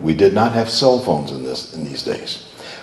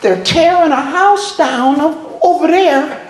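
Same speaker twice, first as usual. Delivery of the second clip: hammed-up child's voice, very high; little boy; 3 dB louder than usual. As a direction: imitate a small boy with amazing news. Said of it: "one little boy said".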